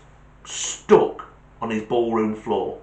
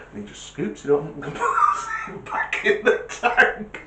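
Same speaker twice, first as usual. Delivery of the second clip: laughing through words; 10 semitones higher than usual. Real, not acted. Teller cracking up at punchline.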